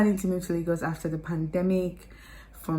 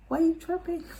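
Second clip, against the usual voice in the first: high, playful sass; teasing high voice; 11 semitones higher than usual. Acted. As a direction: tease them in a squeaky voice. Repeats teasing line higher.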